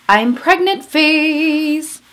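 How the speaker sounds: high, loud, showy proclamation; playful over-the-top announcing; loud announcement. Acted. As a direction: belt it out like a big reveal. Announcing her new phase playfully.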